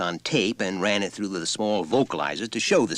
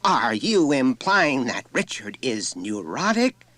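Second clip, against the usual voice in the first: chilled voice; 7 semitones higher, 4 dB louder, slower than usual. A narrator's reading. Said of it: "in a chilled voice".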